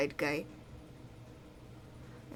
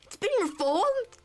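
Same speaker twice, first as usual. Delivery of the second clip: much higher, astonished outbursts; shocked character; 13 semitones higher, 3 dB louder than usual. Acted. Character shocked that the sword has been reformed, high astonished cries. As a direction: gasp it out in disbelief.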